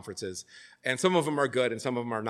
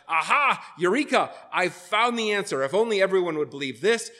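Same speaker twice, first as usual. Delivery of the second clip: higher, louder, excited voice of discovery; mock eureka; 6 semitones higher, 4 dB louder than usual. Preacher imitating a new believer who found the answer, mock excitement. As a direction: act out a young convert's big revelation. Acting out a convert's eager discovery.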